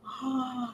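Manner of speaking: sharp gasp of realization; drawn-in breath of surprise; sudden gasp. Real, not acted. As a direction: gasp as a memory hits. Suddenly remembers what happened.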